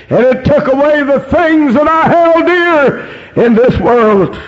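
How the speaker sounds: high, urgent revival-preacher cry; fervent preaching. Real, not acted. Preaching at full force.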